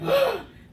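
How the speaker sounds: loud, high gasp of alarm; shocked gasp. Retold or imitated. Storyteller acting out spotting another kid, loud shocked gasp. Retelling shock at hidden child.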